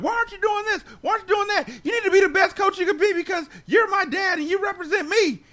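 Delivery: high, loud, insistent shouting; imitated yelling. Kid yelling at dad to be the best coach, mock shouting. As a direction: pile on demands at full shouting pitch.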